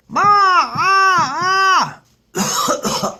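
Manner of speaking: high, loud imitated monkey shriek; monkey yelling. Storyteller imitates the monkey that's not swinging, yelling. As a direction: screech like a monkey until the throat gives out. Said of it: "monkey that's not swinging is yelling".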